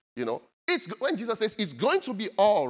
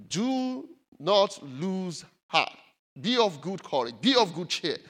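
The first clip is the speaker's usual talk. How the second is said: preaching; slower than usual. Acted. Closing proclamation.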